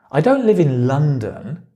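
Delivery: "London" punched, ending on a rise; stress on LONDON. Shown as a demonstration. Teacher showing how stress changes meaning, "London" stressed. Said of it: "where you put the stress changes the meaning".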